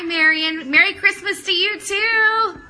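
usual speaking voice

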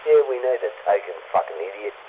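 usual speaking voice

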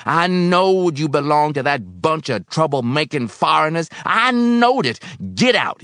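high, loud raging accusation; furious scream. A narrator's reading. Old man ordering union suspect out, screaming. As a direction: scream the accusation at full pitch. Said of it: "he screamed".

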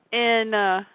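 ordinary talk

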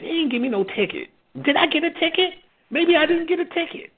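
high, far-off wondering; puzzled. Retold or imitated. Sobering man wondering about his ticket, high puzzled. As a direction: ask yourself, baffled. Confused musing, altered voice.

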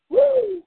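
a sudden high whoop. Acted. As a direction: whoop like at a rodeo. Cheering the tractor gag.

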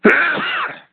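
sudden real sneeze; a sharp sneeze; sneezing. Real, not acted. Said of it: "excuse me, I'm gonna sneeze".

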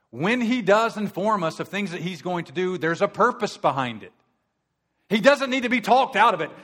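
a pastor pounding the pulpit; full-force preaching. Acted. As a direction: preach it hard, rising.

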